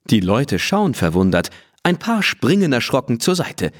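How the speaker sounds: plain reading voice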